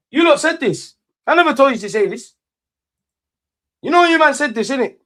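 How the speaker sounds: loud, high, vexed outburst at the fans; vexed rant. Real, not acted. Fed-up fan scolding his viewers, loud and vexed.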